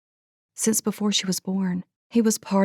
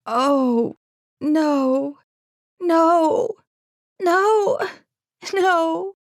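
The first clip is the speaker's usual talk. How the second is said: rising, louder cries of no; breaking into tears, each no higher; crying despair; 11 semitones higher, 4 dB louder, much slower than usual. A narrator's reading. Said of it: "then she did begin to cry".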